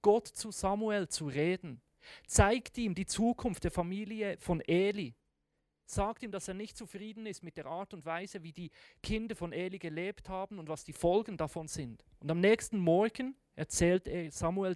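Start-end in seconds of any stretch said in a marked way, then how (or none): none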